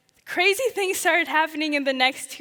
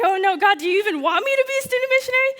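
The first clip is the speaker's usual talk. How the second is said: much higher, rushing and louder, a desperate question; pleading to God in a panicked rush; frantic plea; 6 semitones higher, 4 dB louder, faster than usual. Retold or imitated. Student missionary pleading with God, frantic and rushed. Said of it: "I started freaking out".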